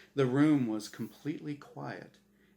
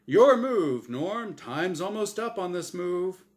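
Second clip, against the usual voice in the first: chipper, higher host voice; game show host Azrael; 6 semitones higher, 7 dB louder than usual. Acted. Azrael pressing Norm to play, bright host voice. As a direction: needle him like a smug quizmaster.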